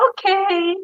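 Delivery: high, unconvinced okay; mock hesitant okay; doubtful okay. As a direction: sound not quite reassured. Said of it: "And I was like".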